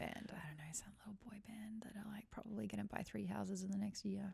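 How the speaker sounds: catty whispered aside. Real, not acted. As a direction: whisper it like gossip.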